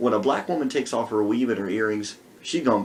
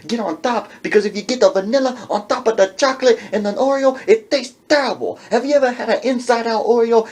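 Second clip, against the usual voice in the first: put-on Chicano accent; 8 semitones higher, 5 dB louder than usual. Man voiced lecturing.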